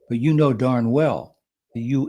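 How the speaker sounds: ordinary talk, nothing marked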